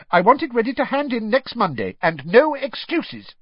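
a stern teacher cutting the class short; snapped. A narrator's reading. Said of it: "she snapped".

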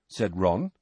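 ordinary delivery, nothing marked

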